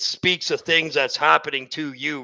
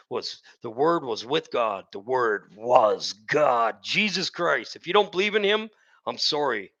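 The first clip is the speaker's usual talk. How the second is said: ordinary talk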